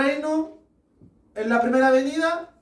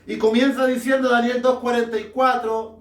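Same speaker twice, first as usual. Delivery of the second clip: normal talking voice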